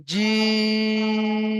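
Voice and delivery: জিম sung out with the vowel held very long; extra-long stretch. Shown as a demonstration. Teacher demonstrating the three-beat stretch on জিম, slow and chanted. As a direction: chant জিম, holding the vowel on one steady note. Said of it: "একটু বেশি টান দিয়ে পড়তে হবে".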